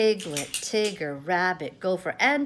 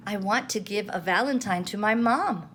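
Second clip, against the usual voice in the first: usual speaking voice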